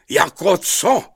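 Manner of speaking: high, loud, incredulous bellow; roared question. A narrator's reading. Wet king roaring back at his castellan. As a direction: roar it out, pitched high. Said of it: "zařval".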